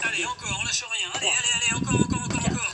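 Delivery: loud, high hype shouting; gym bro. Acted. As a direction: push them hard through the final reps. Coach cheering on the last reps.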